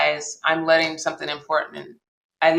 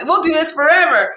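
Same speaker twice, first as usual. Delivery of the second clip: gushing friend; 11 semitones higher, 6 dB louder than usual. Retold or imitated. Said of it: "She's like".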